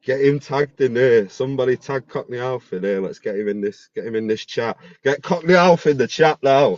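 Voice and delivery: loud, rallying call to the audience. Real, not acted. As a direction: shout it out to get him tagged. Calling out for Cockney Alf.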